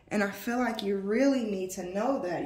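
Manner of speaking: ordinary delivery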